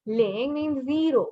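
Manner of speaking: usual speaking voice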